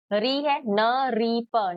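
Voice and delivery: teacher sounding out नृप. Shown as a demonstration. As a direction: say न री प slowly, syllable by syllable. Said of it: "तो इसको मैं पढ़ ऐसे ही रही हूँ".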